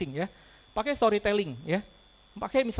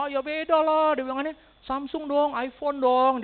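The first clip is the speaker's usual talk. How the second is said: higher, louder, offended protest; indignant client; 8 semitones higher, 7 dB louder, slower than usual. Retold or imitated. Client insisting on Samsung or iPhone, indignant. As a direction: scoff at the idea of a cheap phone.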